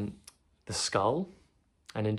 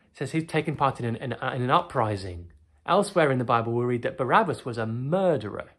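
ordinary talk

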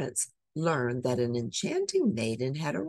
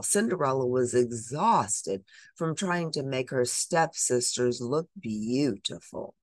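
ordinary delivery, nothing marked

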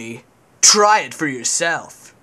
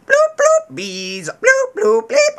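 high loud robotic bleeps; toy robot voice; 5 dB louder than usual. Pretend. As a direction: beep and bloop like a toy robot. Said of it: "I am a robot".

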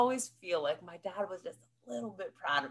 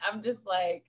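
ordinary delivery

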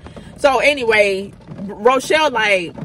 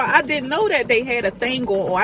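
usual speaking voice

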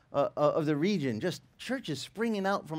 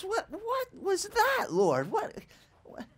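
high, squeaky baffled stammer; mock bewilderment; slower than usual. Stunned pastor asking the Lord what that was, squeaky bewilderment. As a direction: squeak it out in comic disbelief.

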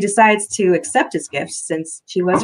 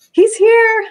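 very high, loud squeal of nerves; octave-jumping excited announcement; high nervous exclamation; 7 dB louder than usual. A narrator's reading. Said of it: "her voice a couple of octaves higher".

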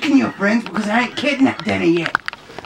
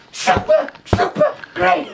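high squeal of delight; squealing excitedly; slower than usual. Shown as a demonstration. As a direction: squeal it, overjoyed. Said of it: "repeats it higher".

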